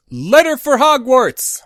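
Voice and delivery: high, slow call into the sky. A narrator's reading. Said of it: "Calling out".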